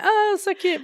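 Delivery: very high-pitched gushy coo; mock cooing. Imitating couple with the giggles, mock cooing. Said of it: "then you're like".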